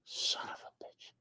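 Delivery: hushed, slow whispered curse; slow dismayed whisper under the breath. Retold or imitated. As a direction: mutter it to yourself in dismay, barely voiced. Alone at airport, nobody came.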